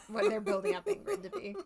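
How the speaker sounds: laughing. Real, not acted. Laughing while talking.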